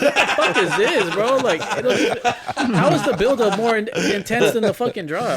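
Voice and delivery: half-laughing rant at a lame drop; laughing exasperation. Real, not acted. Ranting to friends about drops, laughing and loud.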